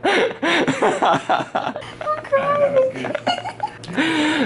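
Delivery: long, high-pitched fit of laughter; laughing hard. Real, not acted. Joke teller reacts to being called stupid, laughing hard. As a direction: crack up helplessly, high and long. Cracking up after the argument.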